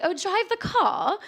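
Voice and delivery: high, slow posh British, no R in "car"; exaggerated posh British, "car" said as "cah"; bad posh British accent. Shown as a demonstration. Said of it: "really bad posh accent".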